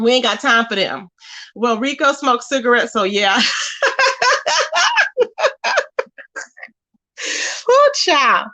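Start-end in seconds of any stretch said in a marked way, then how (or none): 3.4-6.0 high, sustained peal of laughter; long laughing fit. Real, not acted. Streamer tickled by her Rico cigarettes remark, laughing helplessly. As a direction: crack up and keep laughing. Cracks up at own joke.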